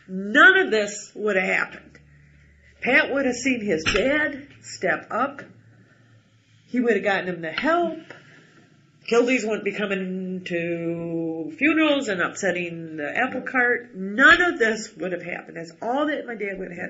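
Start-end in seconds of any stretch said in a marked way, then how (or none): 0.1-2.0 louder, slow, each word weighted; emphatic verdict about the father's failure. Real, not acted. 13.9-15.5 emphatic verdict about the father's failure. Real, not acted.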